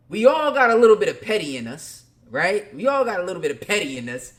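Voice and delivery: hyped-up, teasing crowd line; playful hype. Real, not acted. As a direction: grin through the petty confession. Playful petty admission.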